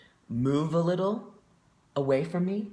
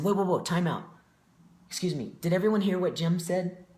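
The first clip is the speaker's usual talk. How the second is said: higher, louder call to halt; retold interruption; 6 semitones higher, 4 dB louder than usual. Group member halting the session over a remark, calling out. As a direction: break in and call the room to attention.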